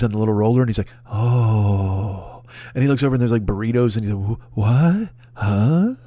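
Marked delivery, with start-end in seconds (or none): 1.1-2.4 low, dazed little oh. Retold or imitated. Bewildered guy at hot dogs. 4.4-5.9 dazed, puzzled what; baffled mumbling; confused what. Retold or imitated. Bewildered guy at burritos.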